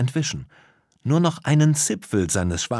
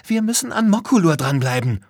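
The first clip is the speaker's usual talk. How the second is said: put-on high, loud girl urging a friend on; pressing, high-pitched shout; urgent call; 10 semitones higher, 4 dB louder than usual. A narrator's reading. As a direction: call out impatiently. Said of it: "rief Lisa".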